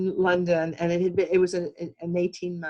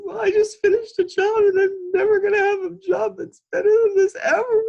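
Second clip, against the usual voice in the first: high, loud mock sob through the words; retold crying; 14 semitones higher, 8 dB louder than usual. Telling the cabbie the job is over, retold high wailing sob. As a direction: replay yourself crying, raised and wailing. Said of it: "And I'm crying because it's over".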